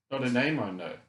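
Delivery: usual speaking voice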